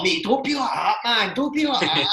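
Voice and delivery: high whiny pleading; pleading drunk passenger. Retold or imitated. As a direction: whine it like a drunk kicked off the bus. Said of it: "He's like".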